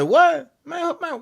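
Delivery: brushing off the guy who grabbed him; annoyed. Retold or imitated. Annoyed when grabbed mid-workout.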